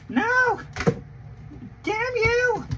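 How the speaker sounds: high-pitched, hammed-up cry of despair; mock outrage. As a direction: wail at the sky like a cartoon villain defeated. Mock outrage at missing movie.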